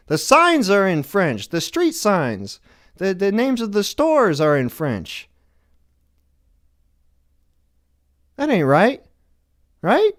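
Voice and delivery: high, loud indignant rant; hammed-up fury at the French signs; mock outrage. Ranting about the French signs in Montreal, loud mock outrage.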